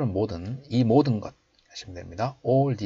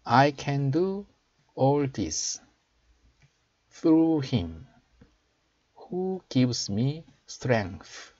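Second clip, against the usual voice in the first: slow, raised teaching voice; slow model reading; 5 semitones higher, much slower than usual. Shown as a demonstration. Teacher showing learners the English verse, slow model reading. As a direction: read it out slowly for learners to copy.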